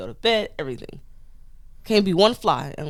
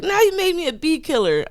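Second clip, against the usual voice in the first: coworker quote; 12 semitones higher, 4 dB louder than usual. Retold or imitated. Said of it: "And he's like".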